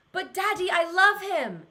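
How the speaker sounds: high, loud pleading Ariel; Little Mermaid line. Retold or imitated. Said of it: "is a line from The Little Mermaid".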